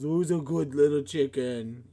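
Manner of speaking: high cooing to the chicks; baby talk. Acted. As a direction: coo to baby birds. Cooing to eaglets.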